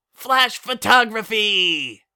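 loud, drawn-out high cheer; cheering kid. Acted. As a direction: shout it like a punchline. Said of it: "He cheers".

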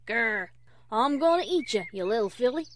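loud, menacing pretend-wolf growl; growled wolf impression. Applejack playing wolf to scare Fluttershy, growling. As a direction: growl hungrily like a storybook big bad wolf. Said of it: "growled Applejack".

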